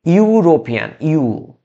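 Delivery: ইউরোপিয়ান opening on a drawn-out "yoo" glide; initial "yu" sound. Shown as a demonstration. Teacher shows students how E and U combine, ইউরোপিয়ান said with a long "yoo". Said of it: "এই দুইটা মিলে উচ্চারণ হতে".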